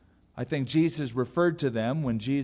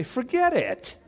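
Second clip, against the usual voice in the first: mock refusal; 10 semitones higher, slower than usual. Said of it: "you'd say".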